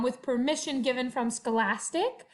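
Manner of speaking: ordinary delivery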